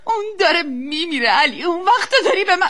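loud, high tearful protest; distraught, rising wail of reproach; anguished outburst. Acted. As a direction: cry out in desperation at your husband. Mother breaks down at husband.

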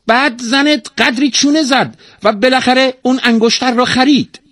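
very high, loud parrot voice; high-pitched, indignant tale-telling bird; talking parrot, aggrieved. A narrator's reading. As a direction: squawk out your complaints as a tattling parrot. Narrator plays the talking parrot.

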